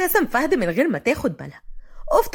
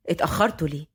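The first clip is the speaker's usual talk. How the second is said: lowered voice of a family elder; grandfather; 8 semitones lower, 3 dB quieter, faster than usual. A narrator's reading. Grandfather to the latecomers, low questioning.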